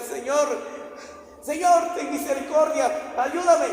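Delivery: high, loud cry for help; desperate shout. Retold or imitated. Peter sinking, shouts to Jesus, desperate cry. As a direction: shout for rescue while sinking. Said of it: "empezó a gritar".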